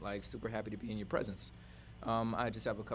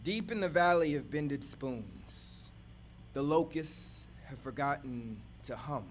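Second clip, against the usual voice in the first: poem reading; 4 dB louder, much slower than usual. Acted. Poet opening an imagery poem for the audience, calm reading. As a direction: paint the images slowly.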